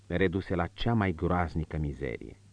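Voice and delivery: ordinary delivery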